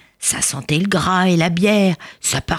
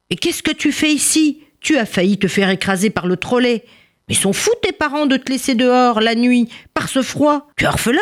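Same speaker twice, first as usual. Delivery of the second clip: put-on higher-pitched scolding of a lost child; alarmed rescuer; 7 semitones higher than usual. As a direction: voice a startled man questioning a child. (sauveteur inquiet qui gronde)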